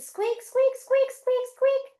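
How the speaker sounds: very high, quick mouse squeaks; little mouse. Pretend. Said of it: "Very nice mice".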